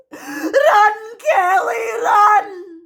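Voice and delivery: high, urgent cry, loudest on the last word; desperate scream. Acted. Frozen captive urging herself to flee, desperate scream. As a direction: scream it in panic, pitched right up. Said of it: "My brain is screaming it".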